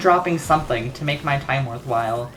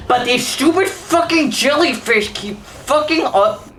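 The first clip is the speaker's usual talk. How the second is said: higher, strained frustrated rant; angry rant; 6 semitones higher than usual. Real, not acted. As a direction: vent at the jellyfish, truly fed up. Ranting at respawning jellyfish.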